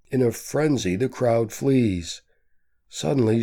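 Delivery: usual speaking voice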